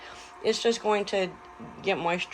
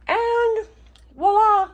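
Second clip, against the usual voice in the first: high, loud ta-da reveal; excited reveal; 13 semitones higher, 8 dB louder, slower than usual. Real, not acted. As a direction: present it like a magic trick. Presenting the ham with flourish.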